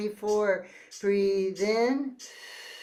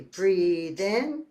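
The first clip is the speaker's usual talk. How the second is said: normal talking voice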